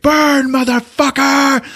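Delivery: high, slow, drawn-out shout; hammed-up gloating war cry; triumphant mock yell. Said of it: "And he's like".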